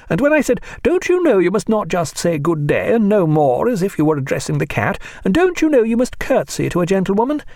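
a haughty lady in a fit of ill temper; Mrs Williams voiced. A narrator's reading.

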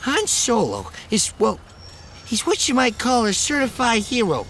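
high, loud, slurred tipsy lecture; town drunk. Acted. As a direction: lecture like a drunk hero fan. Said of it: "slurring his words".

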